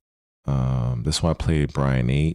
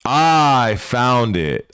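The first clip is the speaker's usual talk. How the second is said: excited find; 8 semitones higher, 7 dB louder, much slower than usual. Real, not acted. Finally finds the match video.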